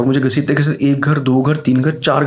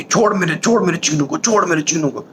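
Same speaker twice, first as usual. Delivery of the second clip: higher, mild mock yell; 4 semitones higher than usual. Grandpa yelling at the sow to free the boy, mild mock yell. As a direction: yell at the sow to let go, lightly. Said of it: "भाई जोर जोर की".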